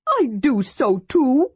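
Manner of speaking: hurt pride; indignant. A narrator's reading. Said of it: "Tass said indignantly".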